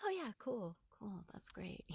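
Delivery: breathless whisper faking calm; panicked whisper. Retold or imitated. Inner voice whispers fake calm before Russian. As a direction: whisper it, pretending it's fine. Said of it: "my heart was like".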